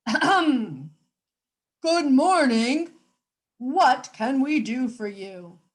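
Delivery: a hint of a stiff butler; character voice. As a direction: clear the throat and greet them grandly. Character voice in read-aloud story.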